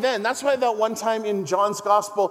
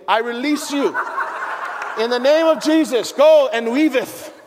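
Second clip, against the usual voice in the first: mock preacher blessing; 4 semitones higher, 5 dB louder than usual. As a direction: ham up a grand benediction while laughing. Mock blessing, releasing the congregation.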